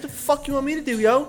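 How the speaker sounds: high, startled protest; panicked Tyler. Retold or imitated. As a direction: throw it back in a high panic. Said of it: "And Tyler was like".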